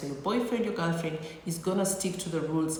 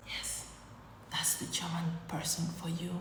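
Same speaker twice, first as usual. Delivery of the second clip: whispered, much softer punchline; hushed aside; 9 dB quieter than usual. Shown as a demonstration. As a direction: drop to a confiding whisper.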